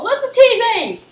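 high, loud call: where's the T V?; loud excited question. Real, not acted. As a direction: shout it out high.

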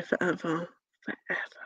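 very soft, dropping to a whisper; hushed, trailing musing; fading to whisper. Real, not acted. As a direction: let it die away to a breath. Muses on blocking him, trailing away.

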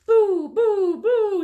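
three high, loud boos; playful scaring. Acted. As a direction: boo at one victim after another. Tried to scare the bucket.

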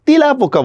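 তেলাপোকা mispronounced 'tilapoka'; first vowel of তেলাপোকা narrowed to an i; wrong vowel. Shown as a demonstration. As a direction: show the unused 'tilapoka' for তেলাপোকা. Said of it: "বলে না কিন্তু কেউ".

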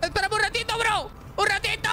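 very high pleading squeak; squeaky desperate plea. Real, not acted. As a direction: beg in a panicked squeak. Repeats plea, even higher.

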